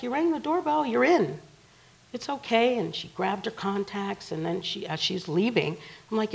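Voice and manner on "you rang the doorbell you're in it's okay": higher, louder retold exasperation; raised-pitch retort, acted out; exasperated reply. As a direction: insist she's already inside. Retold exasperated reply to upset woman.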